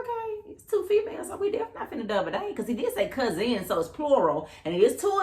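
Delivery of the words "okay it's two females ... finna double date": high, softened doubtful reaction; skeptical inner voice. Retold or imitated. Woman sizing up his two cousins, high and doubtful. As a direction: think it through in a small high voice.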